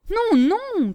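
high, loud cry of refusal. A narrator's reading. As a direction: shout no, no to stop her. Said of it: "criait Gribouille". (cri aigu et fort)

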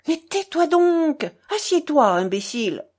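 loud, high exasperated command; angry, scolding order. A narrator's reading. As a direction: shut him up and sit him down. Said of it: "ordonna Grouchenka".

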